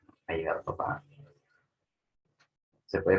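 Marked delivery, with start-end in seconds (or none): 0.3-1.0 Ha llegado papá low, as a question ending on papá; low yes-no question, lift papá; Ha llegado papá with a low-pitched ascending tune. Shown as a demonstration. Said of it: "es un tono ascendente".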